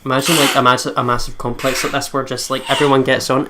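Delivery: ordinary talk, nothing marked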